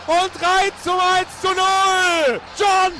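ecstatic goal call, shouted high; screaming goal commentary. Real, not acted. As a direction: yell the goal like a radio commentator. Radio goal call, Cordoba scores.

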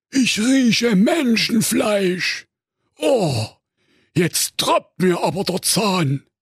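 greedy cartoon giant; ogre voice. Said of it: "Der unbarmherzige Karl-Heinz Menschenfresser aber sprach". (komische Menschenfresser-Stimme)

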